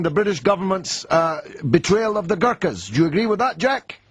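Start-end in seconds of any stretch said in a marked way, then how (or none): none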